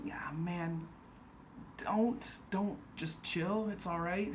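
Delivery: hushed, high, half-whispered calming; soft placating. Acted. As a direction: gently talk down an overeager actor. Director placates an eager actor.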